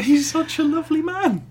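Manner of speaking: sugary fake compliment; mock sweet. Mocking praise after jibe.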